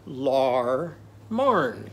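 "Lar marn" in two separated syllables; vowels swapped to "ar". Shown as a demonstration. Teacher shows lemon with Arnie's vowel, spaced "Lar marn". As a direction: stretch both "ar" sounds in "Lar marn". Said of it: "it's gonna sound like this".

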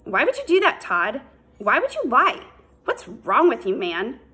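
high, loud accusing demand; angry confrontation. A narrator's reading. Ethan confronts Todd about the lie, high loud anger. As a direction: confront him, voice rising in anger.

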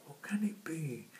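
excited whisper. Acted. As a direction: whisper it eagerly, peering far off. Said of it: "she excitedly asks".

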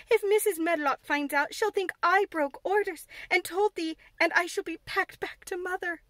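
frantic fear of dismissal; agitated Martha. Acted. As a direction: maid dreading Mrs Medlock, agitated. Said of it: "cried agitated Martha".

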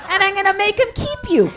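high, loud, laughing mock shout; over-the-top shrieking tirade at her seven-year-old son; hammed-up yelling rant. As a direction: scream at the kid in comic despair.

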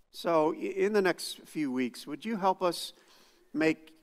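quiet, unhurried prayer voice; soft spoken prayer. Real, not acted. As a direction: pray it humbly to God. Opening prayer before sermon.

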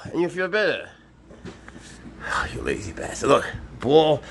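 high, sing-song voice for a pet; pet talk. Real, not acted. Keeper coaxing his pet snake Swaggers, high cooing.

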